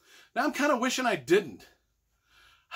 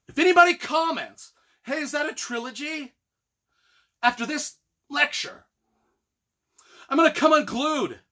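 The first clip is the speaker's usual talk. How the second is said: higher and louder, fed up; exasperated rant; 7 semitones higher, 4 dB louder than usual. Real, not acted. Vlogger warning trilogy commenters, angry exasperated rant. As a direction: warn them you're at your limit.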